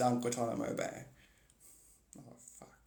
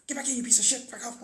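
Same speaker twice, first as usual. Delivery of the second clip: mock angry yell; 10 semitones higher, faster than usual. Acted chase and quarrel.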